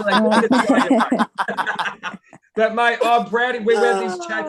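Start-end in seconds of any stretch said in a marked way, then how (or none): 0.0-2.2 fast, louder, laughing through it. Real, not acted. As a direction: rattle it off while cracking up. Joking about Aussie slang.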